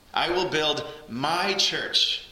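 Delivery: slow, with "will" held and stressed. Shown as a demonstration. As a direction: lean hard on "will". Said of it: "we'll emphasize one word here".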